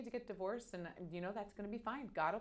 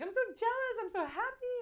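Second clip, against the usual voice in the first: much higher, louder gushing; imitating sister's joy; 8 dB louder than usual. Quoting Kelly's jealous joy at the twins news, high gushing. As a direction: gush like an overjoyed sister. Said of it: "she's like".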